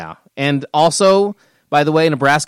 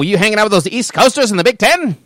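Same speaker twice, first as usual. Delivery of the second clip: put-on old-timey voice; 5 semitones higher than usual. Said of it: "we're back in old-timey voice".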